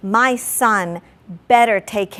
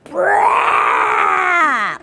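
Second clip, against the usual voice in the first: sudden mock roar at the son; half-acted angry blast at her son; angry outburst; 3 dB louder than usual. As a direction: blurt it like an explosion. Mother exploding at son.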